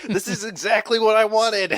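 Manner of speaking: high, loud burst of delight; thrilled squeal. Real, not acted. Friend gets the theater idea they wanted, thrilled squeal. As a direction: gush at full pitch.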